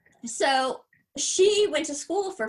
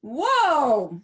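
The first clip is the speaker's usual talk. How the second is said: surprised; 2 semitones higher, 8 dB louder than usual. Real, not acted. Reacts to ghost story.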